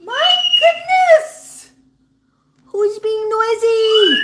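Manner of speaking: loud, high, drawn-out call to noisy birds; loud exclamation. Real, not acted. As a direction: call out high and slow over the din. Calls out over screaming birds.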